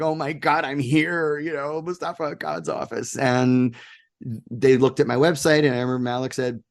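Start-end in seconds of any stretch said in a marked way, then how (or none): 0.0-3.2 retold awe, pitched a little up; mild awestruck disbelief; gobsmacked. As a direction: relive being starstruck, lightly. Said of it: "I was gobsmacked".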